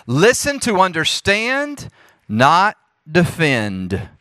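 deliberate slogan, rising on "understand", falling on "defend"; emphatic key point. Real, not acted. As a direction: pause before "not defend" and stretch it.

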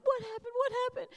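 high, panicked repeated question; frantic asking. Retold or imitated. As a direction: plead to know, voice jumping up. Mother panicked at her son.

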